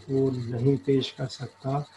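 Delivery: ordinary delivery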